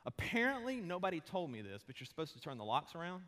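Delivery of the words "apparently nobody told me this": normal talking voice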